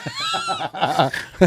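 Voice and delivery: high, helpless laughing fit; real laughter. Real, not acted. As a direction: crack up at a friend's story. Cohost cracks up at the story.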